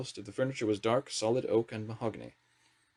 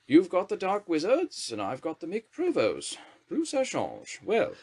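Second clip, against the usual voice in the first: much higher, slow grand lady's drawl; Thatcher's drawl; 9 semitones higher, slower than usual. A narrator's reading. Prime Minister greeting the wizard minister with a haughty drawl. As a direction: look down on the new minister.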